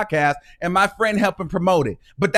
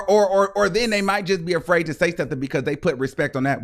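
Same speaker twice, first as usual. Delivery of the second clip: usual speaking voice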